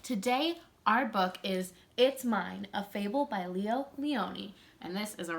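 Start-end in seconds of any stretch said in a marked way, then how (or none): none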